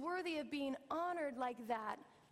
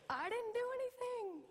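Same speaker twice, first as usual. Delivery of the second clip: put-on higher, girlish voice, defensive; imitating daughter; 8 semitones higher than usual. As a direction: play the young daughter protesting innocence. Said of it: "and Cara's like".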